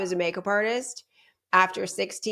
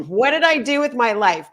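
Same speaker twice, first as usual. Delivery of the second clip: louder, higher exasperated self-questioning; retold dismay; 4 semitones higher, 6 dB louder than usual. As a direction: exclaim it in mock despair at yourself. Retold dismay at her new job.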